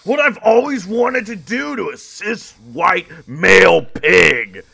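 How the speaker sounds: villain nurse. Pretend. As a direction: savour every word like a cartoon villain. Nurse gloating.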